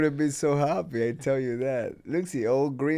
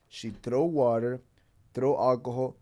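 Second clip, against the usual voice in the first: normal talking voice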